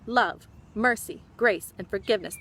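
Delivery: ordinary delivery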